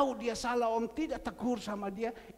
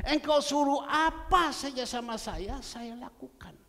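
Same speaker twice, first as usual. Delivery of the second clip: louder vow; 5 semitones higher, 7 dB louder than usual. Retold or imitated. Repeats the man's vow with force.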